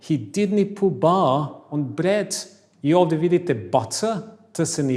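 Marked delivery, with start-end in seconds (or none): 0.1-2.3 Scottish accent. Shown as a demonstration. Teacher repeats the Scottish sentence to class slowly. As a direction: drop every t, Scottish style. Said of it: "Još jedanput".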